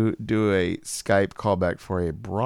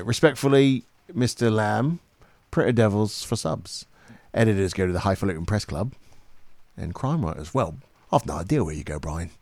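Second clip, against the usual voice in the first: British accent. Shown as a demonstration. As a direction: read the script as a British character. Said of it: "sentence to get into that accent".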